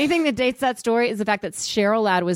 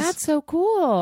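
high, drawn-out "cool"; high-pitched, stretched enthusiasm that could pass for sarcasm; voice going up; 8 semitones higher, much slower than usual. Real, not acted. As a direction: gush "so cool" slowly in a raised voice. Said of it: "when your voice goes up".

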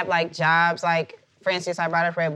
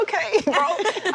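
laughing okay bro; 10 semitones higher, much slower than usual. Retold or imitated. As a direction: laugh it off sheepishly. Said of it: "And I was like".

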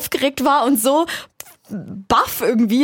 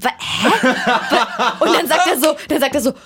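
laughing confusion; 3 semitones higher than usual. Retold or imitated. Fassungslos beim Antrag, lachend.

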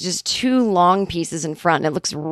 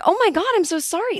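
flustered apology; 11 semitones higher than usual. Retold or imitated. Apologizing to snapping hairdresser.

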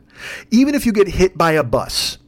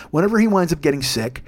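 usual speaking voice

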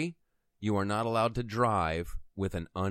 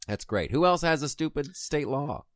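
ordinary talk

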